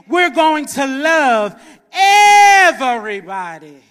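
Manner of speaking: loud, rising preacher's cadence; full-force preaching. Acted. Preacher driving the message home, loud. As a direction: press each line on the congregation.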